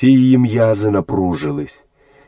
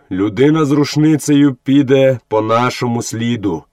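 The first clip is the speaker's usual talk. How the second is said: usual speaking voice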